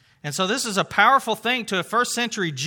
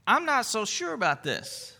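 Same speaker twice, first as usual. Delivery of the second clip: doubting Zechariah; 4 semitones higher, 5 dB quieter than usual. Acted. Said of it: "in the way he says this".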